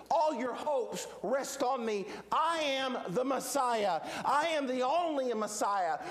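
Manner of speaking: slow, weighty, high-pitched declaration; emphatic proclamation. Acted. Jesus presenting himself as Messiah, emphatic proclamation. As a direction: space out each claim, building insistently. Voicing Jesus's public claim.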